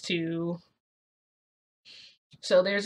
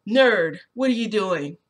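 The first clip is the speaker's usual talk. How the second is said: loud, brusque shout at a classmate; Bakugo calling out; 2 semitones higher, 8 dB louder than usual. Acted. Bakugo yells over to Midoriya, brusque. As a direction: call him out sharply.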